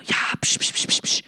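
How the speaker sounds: unvoiced shushing hisses. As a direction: shush the crowd repeatedly. Crowd shushed in the temple.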